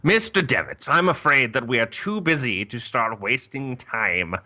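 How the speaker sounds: stern mother superior, measured and firm; nun voice. Acted. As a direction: dismiss the visitor like a busy head nun. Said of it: "when I thought nun voice".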